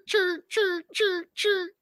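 put-on high squeaky chirps like an old printer; printer chirping noise. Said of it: "printers that's going chirk".

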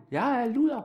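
high, half-hearted bedroom scream; mock scream. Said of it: "hun skreg".

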